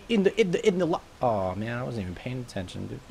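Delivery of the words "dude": ordinary delivery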